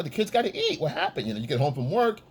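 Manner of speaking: ordinary talk, nothing marked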